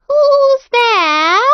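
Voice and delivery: a sick grandmother calling toward the door, high; old grandmother calling. Acted. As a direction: grandmother asking who knocks, high and loud.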